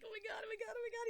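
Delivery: high, whiny fake-sobbing repeats; mock crying Timmy. Timmy crying in the corner, high mock wail. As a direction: play a traumatized kid wailing, hammed-up. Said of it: "Timmy crying in the corner".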